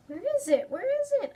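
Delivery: high, loud excited calls; eager child. Acted. Child straining to see the dragon dance, excited. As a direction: bounce up and call out eagerly.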